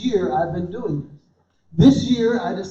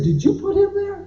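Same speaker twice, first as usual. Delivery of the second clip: high incredulous question; 10 semitones higher than usual. Retold or imitated. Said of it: "And I said".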